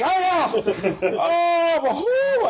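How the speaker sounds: hammed-up high wails of fright; terrified professor. Professor panicking before his students, high mock-terrified wails. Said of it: "and him going".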